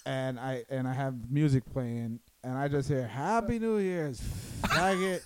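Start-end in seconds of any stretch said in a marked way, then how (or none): 3.1-5.2 slow, high mock holler; retold drunken taunt. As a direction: imitate a rowdy holler, drawn out and pitched up. Repeats friend's taunting greeting.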